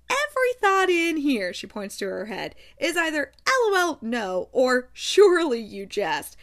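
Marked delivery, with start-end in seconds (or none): 0.1-1.5 incredulous. Retold or imitated. Incredulous outburst at River. 2.8-6.4 incredulous. Retold or imitated. Incredulous outburst at River.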